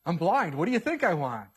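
sarcastic. Pretend. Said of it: "No, that's not what the man says".

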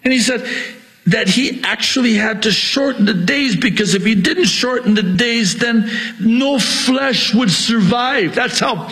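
very high, urgent pulpit cry; impassioned high-pitched preaching yell; real preacher's yelling. Real, not acted. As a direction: hammer the warning out at high pitch. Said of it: "I'm sorry, I'm yelling".

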